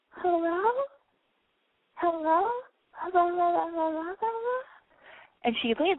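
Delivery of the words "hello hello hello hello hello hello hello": high echoing hellos from down the well; echo yelling back. A narrator's reading. The well echoes the girl's call back at her, high. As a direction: let each hello come back like an echo. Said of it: "she hears yell back up at her".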